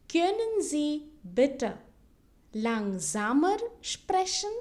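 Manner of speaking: slow German, pausing after "bitte"; slow model phrase. Shown as a demonstration. Teacher models a polite request for learners, slowly in chunks. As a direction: draw out "Können Sie bitte langsamer sprechen" in small chunks. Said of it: "repeat it twice so that you can listen".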